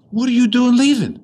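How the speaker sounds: loud, high, incredulous cry; acted high-pitched shout of astonishment; astonished drunk. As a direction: call out in disbelief as the retold drunk. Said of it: "The drunk got sober".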